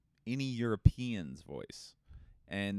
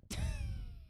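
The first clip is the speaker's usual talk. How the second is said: high squealing laugh; 4 dB quieter than usual. Real, not acted.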